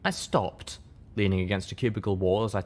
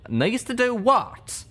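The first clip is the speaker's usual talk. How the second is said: higher, louder, slow baffled question; 4 dB louder, much slower than usual. Acted. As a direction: stretch out the confusion. Calamity voice.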